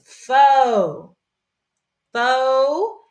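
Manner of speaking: FAUX drawn out loud with a long O; syllable sounded out. Shown as a demonstration. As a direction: stretch FAUX high and loud as a lone syllable. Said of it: "we're gonna read it like this".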